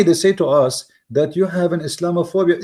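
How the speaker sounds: usual speaking voice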